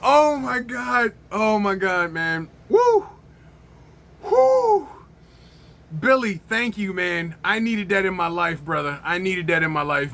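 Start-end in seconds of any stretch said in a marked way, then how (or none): 2.7-4.9 high, loud recovering whoop; high "woo". Real, not acted. Reviewer recovering from a laughing fit, whooping.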